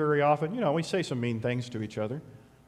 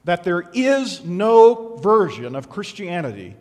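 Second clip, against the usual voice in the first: louder, slow emphatic declaration; forceful preaching; 3 semitones higher, 10 dB louder, slower than usual. Real, not acted. Preacher insisting to the congregation that every disciple carries a cross, forceful and slow. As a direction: drive the point home.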